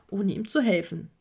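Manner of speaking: ordinary delivery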